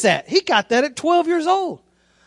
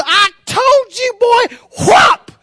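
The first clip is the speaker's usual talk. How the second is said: high, drawn-out angry mom yell; mock scolding mother; 9 semitones higher, 3 dB louder, much slower than usual. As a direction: scold in rhythm with the belt. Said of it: "and she just".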